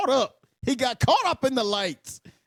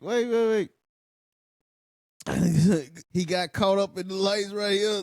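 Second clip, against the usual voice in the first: normal talking voice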